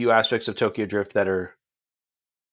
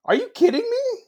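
much higher, laughing in shock; can't believe the score, laugh it out; real laughing surprise; laughing disbelief. Real, not acted. Shocked by a low movie score.

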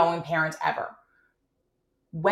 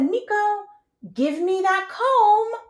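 high, slow sing-song command; sing-songy direction; 13 semitones higher, 3 dB louder, slower than usual. Shown as a demonstration. Mom directing her son to give the comb, sing-songy. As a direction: sing the direction sweetly, slowly. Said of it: "in that sing-songy voice".

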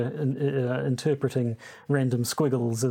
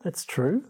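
"true" lifted into a question, a little higher overall; rising terminal; 4 semitones higher than usual. Shown as a demonstration. Host repeating co-host's correction, rising terminal. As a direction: copy the questioning rise on "true". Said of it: "No, you should say".

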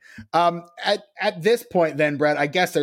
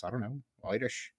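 Irish accent; 2 semitones lower, 13 dB quieter than usual. Shown as a demonstration. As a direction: demo a quiet stage-Irish lilt that slips toward Liverpool. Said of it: "maybe you kinda sound something like this".

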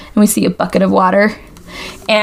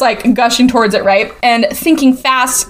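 usual speaking voice

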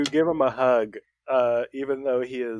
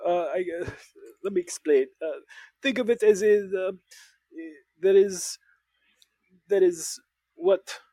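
put-on French accent; 6 semitones higher than usual. French character explaining elegance.

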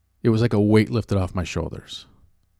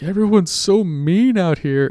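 mock sobbing; 9 semitones higher, 3 dB louder than usual. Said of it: "like, sobbing".

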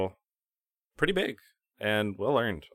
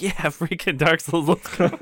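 excited cheer; 9 semitones higher, 5 dB louder than usual. Retold or imitated. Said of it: "I'm like".

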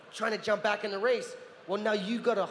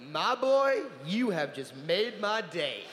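Christopher Lee; slower than usual. Retold or imitated. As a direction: play a grand old film star. Christopher Lee laughs at the boy.

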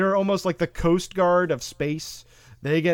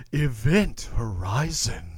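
slow, low title announcement; trailer voice; 5 semitones lower, slower than usual. Pretend. Dramatic title announcement again.

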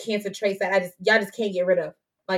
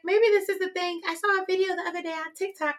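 much higher, softer put-on mom voice; mom's voice; 10 semitones higher, 4 dB quieter than usual. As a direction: play the mom, prim and sweet. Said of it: "I don't know why I'm talking about her like that".

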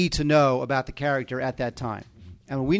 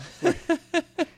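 high-pitched laughing fit. Real, not acted. Recalling a cartoon bit he won't share, high laugh. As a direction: laugh at your own remembered gag.

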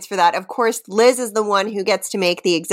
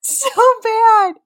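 laughing squeal; 13 semitones higher, 4 dB louder than usual. Real, not acted. Reacts laughing.

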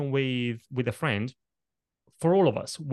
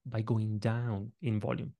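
softer demo; 3 semitones lower, 5 dB quieter than usual. Shown as a demonstration. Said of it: "achieve the same benefit by going down".

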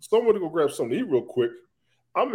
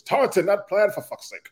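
Nikki impression; 6 semitones higher, 4 dB louder, slower than usual. Replaying a friend's comment in her voice, mock exasperation. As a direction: mimic her correction, starting high and loud. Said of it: "hear the last comment in her voice".

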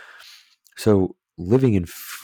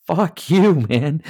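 sudden high, loud outburst at the husband; a mock furious wife yelling at her husband; angry retort; 10 semitones higher, 4 dB louder, much slower than usual. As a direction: snap it back, shouting. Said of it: "And you're like".

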